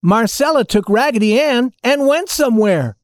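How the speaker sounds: high doll chorus voice; dolls answering together. A narrator's reading. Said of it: "all the dolls answered together".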